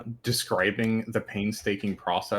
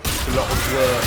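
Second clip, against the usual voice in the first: called-out British It's a lot of work; hammed-up across-the-pond accent; 3 dB louder than usual. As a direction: exaggerate the British vowels. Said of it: "That's the way they say that".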